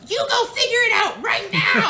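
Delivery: high, loud laughing order; bossy command. Retold or imitated. Stacy ordering her husband to find Michael, loud and high. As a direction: bark it at him, half laughing. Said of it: "she goes".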